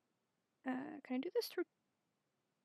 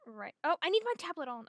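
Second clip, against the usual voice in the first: normal talking voice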